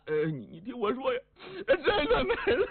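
high, wailing mock sobs over the words; hammed-up blubbering, rising into a high cry; fake crying. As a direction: put on tears to scare her. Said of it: "程咬金这么一掉眼泪".

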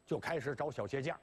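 plain reading voice

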